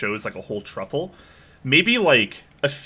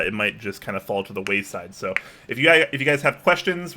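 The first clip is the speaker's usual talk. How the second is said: ordinary delivery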